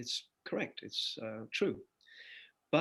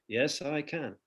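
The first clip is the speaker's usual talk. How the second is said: ordinary talk, nothing marked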